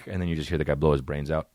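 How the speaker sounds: ordinary talk, nothing marked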